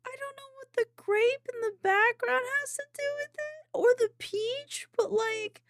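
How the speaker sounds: very high, happy squeaky whine; touched, near tears. Real, not acted. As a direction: squeak it slowly, overcome with happiness. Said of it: "you're gonna make me cry".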